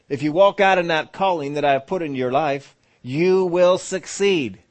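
high, loud, very slow on the end; booming promise. Acted. The Lord promising success to the doubter, booming. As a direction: call it out like a divine promise.

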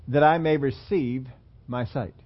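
slow, weighty "receive", then "my sight" drops away; stress on receive. Retold or imitated. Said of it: "He puts his emphasis on the receiving".

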